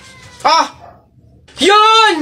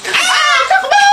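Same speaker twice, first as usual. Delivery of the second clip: high frantic yell to run; panicked shout; much slower than usual. Real, not acted. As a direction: scream to flee. Caught, running away.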